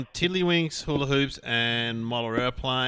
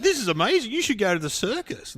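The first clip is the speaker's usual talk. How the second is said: high-pitched acted wonder; mock amazement; 8 semitones higher than usual. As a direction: marvel as the joke's barman. Joke barman amazed by talking dog.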